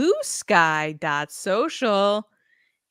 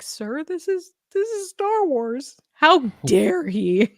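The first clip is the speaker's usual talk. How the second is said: outraged fan; 8 semitones higher, 4 dB louder, slower than usual. Retold or imitated. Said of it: "someone's like".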